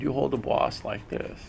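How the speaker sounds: lightly imitated Japanese accent. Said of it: "But he had an accent".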